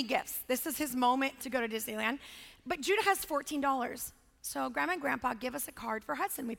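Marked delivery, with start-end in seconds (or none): none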